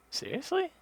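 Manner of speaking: very high, loud “Seriously?”; incredulous. Retold or imitated. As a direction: re-enact exasperated disbelief, pitch shooting up. Acting out disbelief while waiting.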